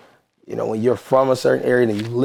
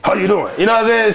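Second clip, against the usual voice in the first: higher-pitched mock New York greeting; aggressive New York; 8 semitones higher, faster than usual. Guest imitating New Yorkers to show how aggressive they sound, mock aggressive. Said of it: "it just sound aggressive".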